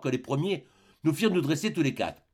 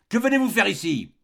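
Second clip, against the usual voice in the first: loud, high, furious cry at the intruding priest; furious deep shout; 7 semitones higher, 6 dB louder than usual. A narrator's reading. Uncle confronting the priest in his room, furious shout. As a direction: roar it out, outraged. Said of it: "il cria d'une voix forte, profonde, furieuse".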